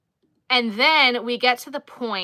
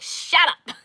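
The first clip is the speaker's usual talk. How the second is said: mock snap; 8 semitones higher, 6 dB louder than usual. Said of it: "I was like".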